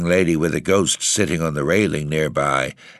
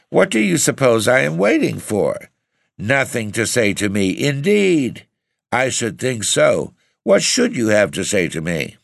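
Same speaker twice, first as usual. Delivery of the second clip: indignant outburst; 6 semitones higher than usual. Acted. Madeline, insulted, storms at him indignantly. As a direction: hurl each line at him, stung and proud. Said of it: "she cried".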